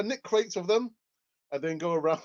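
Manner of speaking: ordinary delivery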